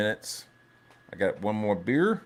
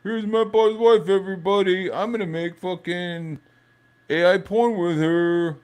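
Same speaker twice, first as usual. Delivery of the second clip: higher, louder taunting mock voice; 8 semitones higher, 3 dB louder than usual. Streamer mocking Mike Wheeler taunting everyone, higher and louder. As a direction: sneer it like a gloating troll. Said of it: "be like".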